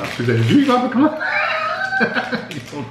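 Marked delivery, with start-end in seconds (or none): 0.4-1.2 high, loud playful accusation; mock accusing shout. 1.3-2.5 squealing high laugh; high burst of laughter. Real, not acted. Laughs after mock accusation.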